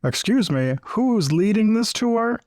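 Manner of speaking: high, slow, prissy tour guide interrupting; mock the guide scolding chatty tourists; annoyed tour guide. Tour guide scolding the chatty group, in a high mock voice. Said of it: "The tour guide's like".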